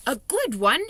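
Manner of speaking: loud incredulous echo of the question; indignant cry. A narrator's reading. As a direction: scoff that she could doubt it. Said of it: "he cried".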